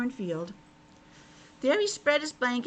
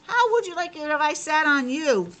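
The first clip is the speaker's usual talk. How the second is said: higher, louder indignant frog voice; grumpy frog; 6 semitones higher, 5 dB louder than usual. Acted. Grumpy frog scolds the piglet who sat on him. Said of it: "grumpy frog scolds piglet".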